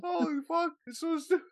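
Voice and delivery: normal talking voice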